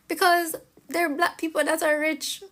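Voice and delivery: high, mocking voice for the other side; mocking quote. Mocking the critic's argument in a high, put-on voice.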